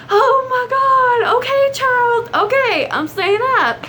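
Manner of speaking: high, slow, whiny surrender with a sob; mock wail of an exhausted mom; tired whining give-in.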